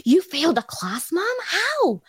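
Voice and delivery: put-on high, astonished child voice; little daughters. Young daughters amazed, asking mom how she failed. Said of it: "They're like".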